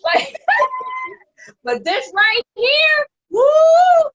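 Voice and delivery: high, loud laughing squeal; cracking up over the fan; laughing loud and high through the words. Real, not acted. Laughing about fan saving her.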